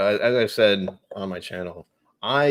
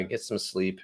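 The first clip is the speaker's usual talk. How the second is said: ordinary talk, nothing marked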